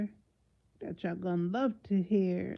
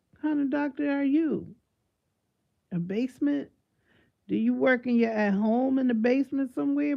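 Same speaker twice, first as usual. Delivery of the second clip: loud mocking; 6 semitones higher, 5 dB louder than usual. Real, not acted. Mocking the doctor's credentials.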